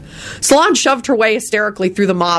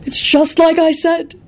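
high, slow gloating wail; triumphant wail; 11 semitones higher, slower than usual. A narrator's reading. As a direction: wail it like a vindicated prophet. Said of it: "Sybil wailed triumphantly".

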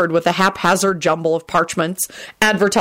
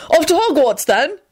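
cheerful; 11 semitones higher, 3 dB louder than usual. Acted. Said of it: "George said cheerfully".

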